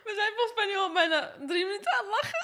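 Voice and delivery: fast, high laugh-crying; talking through giggles. Real, not acted. Laughing fit.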